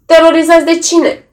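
losing patience, snap it out; impatient shout. A narrator's reading. Lawyer loses patience with clients, impatient shout. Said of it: "strigă Zumo, pierzându-și răbdarea".

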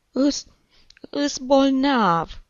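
put-on high, loud, drawn-out wail of a sick boy; falter, then wail it slowly like a crying child; tearful stammering child. A narrator's reading. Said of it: "îngăimă Egorușca". (copil bolnav care îngaimă plângăreț)

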